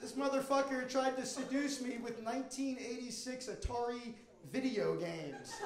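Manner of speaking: normal talking voice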